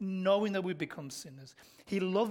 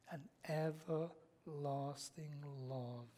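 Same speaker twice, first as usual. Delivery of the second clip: soft, slow, drawn-out words; tender reverence; 4 semitones lower, 9 dB quieter, slower than usual. Real, not acted. Preacher quoting God's love from Jeremiah, tender and slow. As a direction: linger softly on each word.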